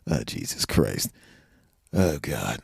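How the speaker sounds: low, slow under-the-breath groan of disbelief; muttered dismay. Retold or imitated. Comedian seeing the club owner's powdered nose, low slow mutter. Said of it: "I'm going".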